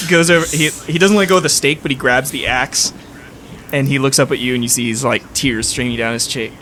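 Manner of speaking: ordinary talk, nothing marked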